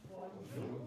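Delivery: whispered bonjour for the learner to repeat. Shown as a demonstration. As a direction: breathe bonjour softly with no voice. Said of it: "let's try them one more time".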